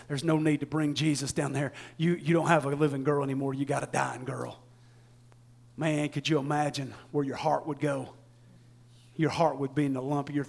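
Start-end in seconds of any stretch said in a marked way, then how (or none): 0.1-4.6 soft, low bad news; somber messengers. Messengers telling the father his girl is gone, softly. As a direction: deliver the death gently. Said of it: "and they said".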